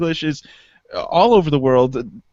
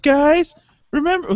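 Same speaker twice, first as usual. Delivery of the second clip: mock mom voice; 11 semitones higher than usual. Mock parent at sleepover.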